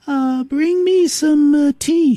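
a spoiled queen drawling her order; haughty queen bee. Pretend.

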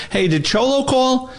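high, drawn-out question from an impatient colleague; urgent questioning. Retold or imitated. Retold detective urgently questioning the narrator.

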